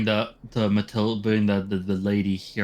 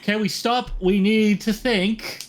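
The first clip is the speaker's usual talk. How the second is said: exasperated pleading; 9 semitones higher, slower than usual. Real, not acted. Pleading to stop the bickering.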